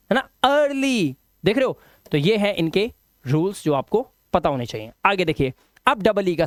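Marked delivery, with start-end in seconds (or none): 0.4-1.1 "अर्ली" slow, starting on open uh; corrected vowel. Shown as a demonstration. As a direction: draw out "अर्ली" as ur-lee. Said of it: "आ की आवाज".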